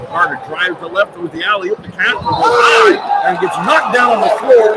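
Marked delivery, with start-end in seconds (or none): 1.8-4.7 excited call. Real, not acted. Big play under the basket.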